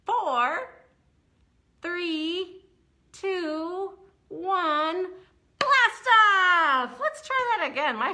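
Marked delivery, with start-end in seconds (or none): 0.0-5.1 countdown. Acted. Rocket countdown for kids. 5.6-6.9 blast off shout. Acted. Rocket launch shout.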